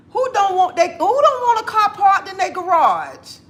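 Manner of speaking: high-pitched playful outrage; incredulous question. Acted. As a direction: throw it out high, as if it were obvious. Incredulous comeback to critics.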